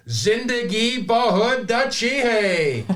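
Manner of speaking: belted out high, loud and slow; loud Urdu phrase. Shown as a demonstration. Guest shows off his Urdu, belting it out loud. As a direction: sing out the Urdu line with gusto. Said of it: "I hope everyone's ears are okay after that".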